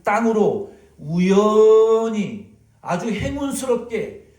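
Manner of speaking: slow, loud, high on 우연히 and 행운스럽게; stretched emphasis. Acted. Preacher mocking reliance on luck, slow loud emphasis.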